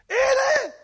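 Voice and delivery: long, high, loud cry; exultant drawn-out shout; triumphant reveal. Acted. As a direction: belt it out holding up the find. Lifts out the treasure box.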